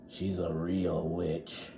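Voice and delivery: low, slow, hushed warning; spooky warning to little kids; ominous big kid. Acted. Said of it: "said the big kid".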